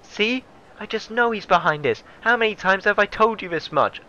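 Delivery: high, nagging feminine character voice; exasperated secretary. Acted. Secretary accuses Shirakawa to the boss, high exasperated voice. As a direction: pitch it up and insist to the boss. Secretary insists the rival is guilty.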